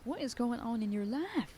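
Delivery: ordinary delivery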